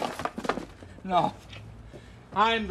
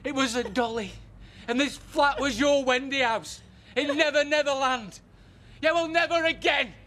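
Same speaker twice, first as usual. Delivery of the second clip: mocking laughter; 6 semitones higher than usual.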